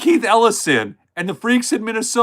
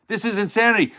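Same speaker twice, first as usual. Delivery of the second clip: ordinary delivery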